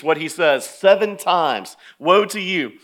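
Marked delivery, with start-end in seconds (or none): 2.0-2.7 louder, higher proclamation; in-their-face rebuke. Acted. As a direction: pronounce judgment with relish. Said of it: "Jesus gets in their face".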